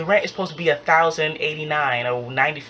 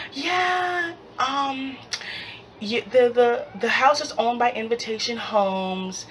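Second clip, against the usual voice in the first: Karen; 8 semitones higher than usual. Retold or imitated. Invitation Homes lady breaking bad news, high hesitant voice. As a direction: imitate a high-voiced, awkward office caller.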